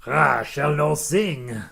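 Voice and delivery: loud, slow mock-French declamation; French accent. Host playing viewer refusing to sing, loud mock French accent. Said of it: "I'll do a French".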